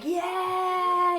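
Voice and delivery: long, high held whoop; retold drunken cheer. Friend knocking drinks back, high drawn-out cheer. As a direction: imitate a tipsy friend cheering.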